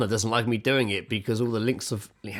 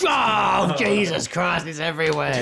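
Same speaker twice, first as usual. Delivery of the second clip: higher, louder fake panic; mock horror; 7 semitones higher, 4 dB louder, slower than usual. Friend acting out panic near spilled flour, higher mock horror. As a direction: recoil from imaginary dust.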